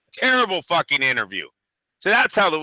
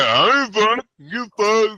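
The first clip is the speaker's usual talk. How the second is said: mock cocky fighter; 7 semitones higher, slower than usual. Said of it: "he goes like".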